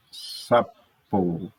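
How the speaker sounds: SAPO broken into sa, po; syllable by syllable. Shown as a demonstration. Teacher picking the two-syllable word sapo, syllable by syllable. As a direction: say sapo with a gap between syllables. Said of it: "uma palavra que só tenha duas sílabas".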